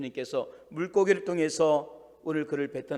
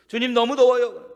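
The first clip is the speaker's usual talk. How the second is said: whiny complaint; 6 semitones higher, 6 dB louder than usual. Acted. Jonah complaining of heat.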